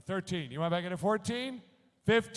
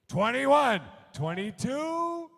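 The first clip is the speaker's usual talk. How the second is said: excited call; 7 semitones higher, 7 dB louder, much slower than usual. Real, not acted. Auctioneer calls new bids.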